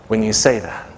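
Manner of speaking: ordinary delivery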